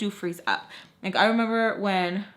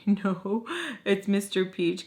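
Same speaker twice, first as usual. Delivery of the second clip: normal talking voice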